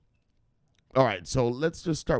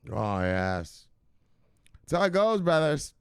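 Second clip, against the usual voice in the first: ordinary delivery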